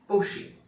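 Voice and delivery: पोशे high, as "PO-shi"; correct Porsche pronunciation. Shown as a demonstration. Teacher repeats the real word, careful pronunciation. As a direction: punch the "po" of पोशे, short ending. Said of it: "इसका असली शब्द".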